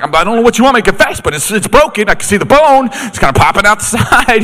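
hammed-up frustration at a stubborn friend; exasperated protest. Retold argument over broken ankle.

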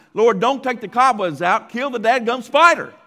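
loud preacher retort. Retold or imitated. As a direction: boom it out like a fed-up pastor. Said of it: "he said in a loud voice".

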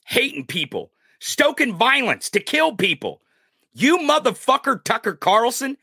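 high, raw yell at full force; furious yelling. Real, not acted. Ranting viewer yelling at Tucker Carlson, furious shout. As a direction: scream it until the voice might go. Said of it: "I wanna lose my voice on this one".

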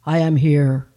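low, loud divine voice; answering voice of God. Acted. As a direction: boom it out as God from above. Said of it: "she heard an answering voice".

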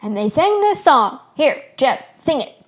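excited urging. A narrator's reading. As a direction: urge him on at full voice. Excited shepherd urges friend to sing.

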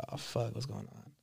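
mock-alarmed near whisper; retelling the shock under his breath; hushed panic. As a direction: breathe it out, barely audible. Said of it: "you're like".

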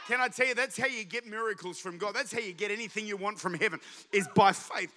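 ordinary talk, nothing marked

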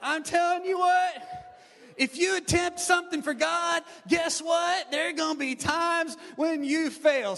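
high, loud preacher's proclamation; full-force preaching. Real, not acted. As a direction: belt it out to the whole congregation.

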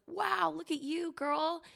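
high, slow, impressed voice of a passing mom; cheering woman. Retold or imitated. Single mom retells a stranger cheering her up the ramp, high and impressed. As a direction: play the admiring stranger who cheers her on. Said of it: "another woman ... was like".

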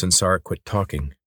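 ordinary delivery

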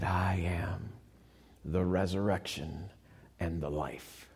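soft, low, awed whisper; reverent hush. Acted. Preacher voicing Jesus to grieving sister, hushed and reverent. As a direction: breathe it like a holy secret.

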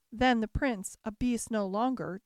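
plain reading voice

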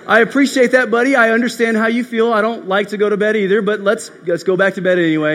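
loud, high mock enthusiasm for a child; acted forced patience, pushed up in pitch and volume; over-bright exasperated dad. Dad answers son who dislikes bedtime, loudly over-enthusiastic.

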